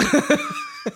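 high burst of laughter; real squealing laugh. Real, not acted. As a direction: burst out laughing at a silly pun. Cracking up at the Focus pun.

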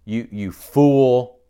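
you fool drawn out slowly and loud; slow mock insult. Shown as a demonstration. Preacher acting out an insult for the congregation, slow and loud. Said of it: "So you have anger".

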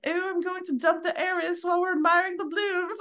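put-on posh British accent, high and airy; grand British dowager voice; Maggie Smith. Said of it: "channel Maggie Smith and be like".

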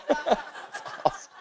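squeaky, voiceless wheeze of laughter; breaking up laughing. Real, not acted. Preacher overcome by his own fishing demo, wheezing laughter. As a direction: squeeze the words out through giggles.

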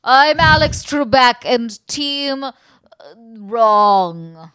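lightly voiced smug game-show host; slow, a touch pompous host; Alex Trebek. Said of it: "Do you know who I am".

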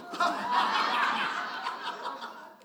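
breathless wheezing laugh, high; high airy laugh. Real, not acted. Pastor cracking up at his own story, wheezing laugh. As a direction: let the laugh run out of air.

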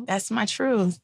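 ordinary talk, nothing marked

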